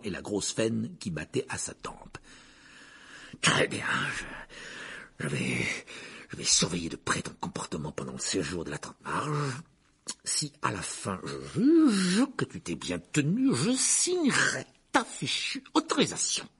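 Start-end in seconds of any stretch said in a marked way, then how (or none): 3.4-9.5 low, halting, strained concession; grudging uncle. Acted. Furious uncle grudgingly gives in. 10.2-16.5 slow build to a high outburst; exasperated uncle. Acted.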